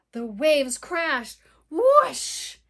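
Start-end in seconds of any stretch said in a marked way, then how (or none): 1.7-2.6 high, loud crashing whoosh; wave whoosh. Acted. Imitating crashing waves.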